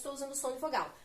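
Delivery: usual speaking voice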